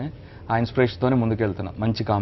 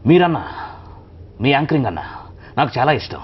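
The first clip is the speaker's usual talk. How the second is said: Rajinikanth impression; 3 semitones higher than usual. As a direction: flatter the host in the superstar's voice. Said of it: "Rajinikanth గారిదండి".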